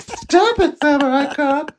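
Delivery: high, light scolding; a high mock reprimand; mock scolding woman. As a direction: chide him in a squeaky voice. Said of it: "She's like".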